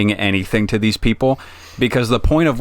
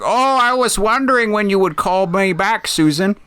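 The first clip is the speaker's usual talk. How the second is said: put-on much higher pitched sweet old lady; 11 semitones higher than usual. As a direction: answer the phone as a little old lady. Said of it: "like, an old lady voice".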